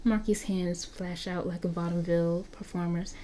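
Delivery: ordinary delivery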